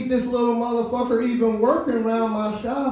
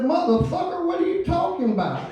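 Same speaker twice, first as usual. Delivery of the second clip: much higher shocked outburst; shocked disbelief; 11 semitones higher than usual. Retold or imitated. Boss shocked by teen's drug talk, high disbelief. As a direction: pitch up in shock.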